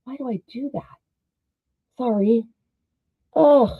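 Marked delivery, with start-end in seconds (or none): 3.3-3.7 frustrated groan. Real, not acted. Frustrated at a sewing mistake.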